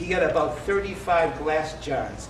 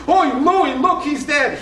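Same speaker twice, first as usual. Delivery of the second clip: high, loud alarmed yell; mock panicked yell at the TV; yelling grandmother; 9 semitones higher, 6 dB louder than usual. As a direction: cry out high to your husband in mock panic. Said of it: "My grandmother was yelling".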